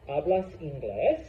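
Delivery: "hablas inglés?" with pitch climbing on "inglés"; question intonation. Shown as a demonstration. Teacher showing Caden how a spoken question rises, rising question tune. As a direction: lift "inglés" at the end to ask. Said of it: "There is a rising pitch towards the end".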